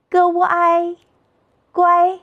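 "歌乌ai 乖" chanted high and level; three-part blend "歌乌ai 乖", all first tone; pinyin blending. Shown as a demonstration. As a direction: sound out "歌乌ai", then join as "乖" on one high note. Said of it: "三拼音节".

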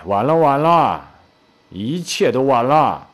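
slow, drawn-out resignation; long, slow pronouncement of doom; mock-doleful lament. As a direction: stretch each word as if all is lost. Friend solemnly declares it hopeless.

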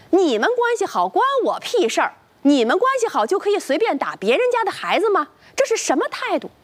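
high-pitched angry outburst, narrated without extra volume; indignant yelling. A narrator's reading. Angry parent rebuffing the man's apology, indignant and high-pitched. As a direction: voice an outraged parent's rant, pitched well up. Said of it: "他大声嚷嚷道".

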